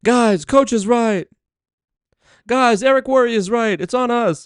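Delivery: high, slow rallying cry; motivational speaker impression. Imitating MLM speakers riling up a crowd, high rallying cry. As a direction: rile up the crowd, mock-earnest. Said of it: "motivational speakers when they're trying to".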